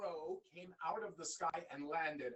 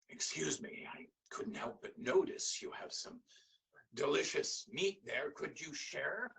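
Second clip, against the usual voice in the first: whispering panther; 2 semitones lower than usual. Acted. Panther whispers to the young man for his meat. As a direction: purr it slyly, menacing and sweet.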